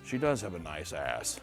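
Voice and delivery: lightly voiced low creepy bachelor; mock Brett. Imitating the bachelor leering at a dancer, low and soft. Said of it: "Brett turns to Enna and says".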